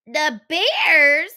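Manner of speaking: high, loud hurried exit; panicked girl. Acted. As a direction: scramble to leave in fright.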